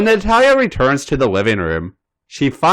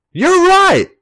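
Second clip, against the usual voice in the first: much higher, louder agreeing squeal; 6 dB louder than usual. Acted. Natalia giving in to Nikki, high and loud agreement. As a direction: chirp it out brightly.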